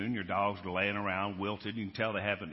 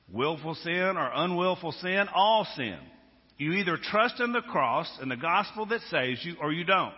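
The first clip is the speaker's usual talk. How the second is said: louder, higher preacher's cry; preaching at full force; 8 semitones higher, 7 dB louder than usual. Acted. Preacher to congregation, ringing full-force preaching. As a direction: drive it home to the congregation.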